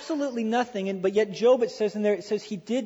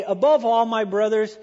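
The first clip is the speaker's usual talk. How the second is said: louder, ringing preacher emphasis on Above; emphatic buildup; 7 dB louder than usual. Shown as a demonstration. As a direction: proclaim it to the whole congregation. Building the expected line.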